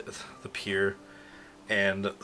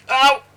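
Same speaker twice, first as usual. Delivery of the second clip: very high, loud "ow"; hammed-up high yelp; pained yelp; 16 dB louder than usual. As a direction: squeal from a sore stretch. Character stretches, hits sore spot.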